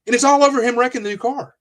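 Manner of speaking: worked-up disbelief. Retold or imitated. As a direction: protest it in a high, incredulous voice. Mocking own rage over the car.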